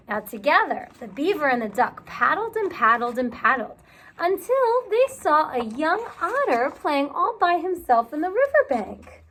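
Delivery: ordinary delivery, nothing marked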